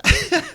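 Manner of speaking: high burst of real laughter. Real, not acted. Remembering a favourite movie scene: real high laugh. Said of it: "I'm just laughing thinking about it".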